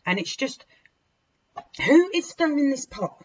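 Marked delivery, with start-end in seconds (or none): 1.9-3.2 higher, louder, demanding who; outraged cry; angry demand. Real, not acted. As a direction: call out the troublemaker. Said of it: "sorry I'm swearing a lot".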